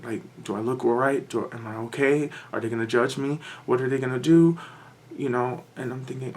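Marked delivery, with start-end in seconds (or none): none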